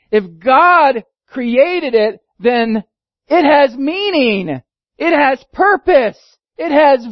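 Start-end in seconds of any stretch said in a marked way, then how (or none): none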